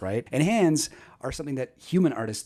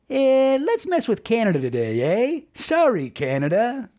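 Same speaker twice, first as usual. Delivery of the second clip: high-pitched, hammed-up Canadian hacker; over-the-top Canadian accent; 10 semitones higher, 4 dB louder than usual. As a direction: play a cartoon Canadian, pitched way up. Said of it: "speaking in a really over-the-top Canadian accent".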